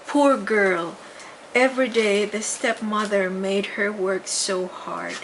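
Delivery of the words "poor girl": usual speaking voice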